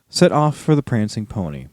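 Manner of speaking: ordinary delivery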